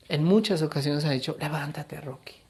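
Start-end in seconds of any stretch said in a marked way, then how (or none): 1.4-2.3 soft, falling away at the end; quiet urging. Acted. God telling His beloved to get up, quietly. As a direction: say it softly, almost under the breath.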